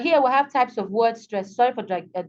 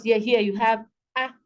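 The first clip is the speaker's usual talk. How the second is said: ordinary talk, nothing marked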